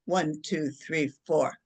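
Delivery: low, even count with spaces between numbers; measured counting. Shown as a demonstration. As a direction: count them calmly through the breath. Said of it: "and I'll go".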